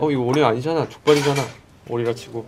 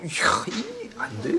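exasperated exclamation; 10 semitones higher, 5 dB quieter, much slower than usual. Real, not acted.

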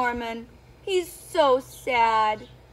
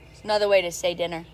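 ordinary delivery

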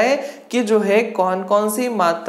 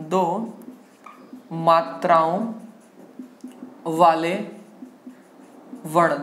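word-by-word dictation; 4 semitones lower, 3 dB quieter than usual. Shown as a demonstration. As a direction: lower voice, long pause after each word. Teacher dictates heading word by word.

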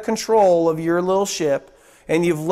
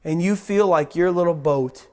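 intimate altar call; 7 semitones lower than usual. Acted. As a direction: draw the listener in gently.

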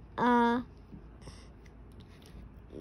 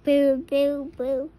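spooky boo; 7 dB louder than usual. Shown as a demonstration. Reading noise book to child.

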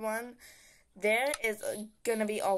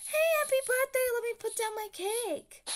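high-pitched party greeter; cheery birthday friend; 13 semitones higher than usual. Acted. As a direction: play the excited friend bringing cake. Friend voiced greeting with cake.

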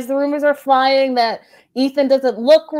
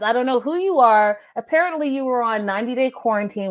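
normal talking voice

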